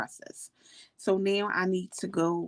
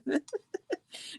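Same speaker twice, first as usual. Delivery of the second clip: laugh; 9 semitones higher, 6 dB quieter than usual. Real, not acted. Laughing about happy tears.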